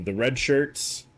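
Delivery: ordinary delivery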